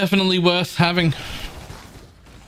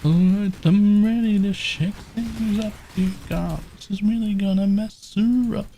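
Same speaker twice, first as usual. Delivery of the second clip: game character voice; 6 semitones higher than usual. Retold or imitated. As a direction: echo the hero's battle line theatrically. Echoing the game character line.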